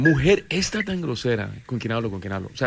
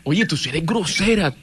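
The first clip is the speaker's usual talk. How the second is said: higher-pitched, taken-aback protest; 6 semitones higher than usual. Real, not acted. Caller to the woman who snubbed him, taken aback. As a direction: protest her rudeness in a raised pitch. Reacting to her rude retort.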